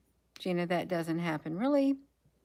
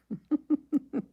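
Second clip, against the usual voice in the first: short high mocking laugh; a bright scoffing laugh; 9 semitones higher than usual. Real, not acted. Mocking the slogan.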